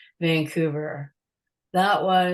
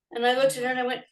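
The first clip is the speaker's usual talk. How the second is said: normal talking voice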